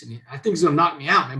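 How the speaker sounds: ordinary delivery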